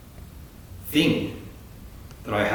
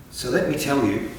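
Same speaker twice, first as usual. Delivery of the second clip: normal talking voice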